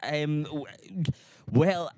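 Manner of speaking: high, flustered stalling; stammering high panic. Retold or imitated. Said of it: "And I was like".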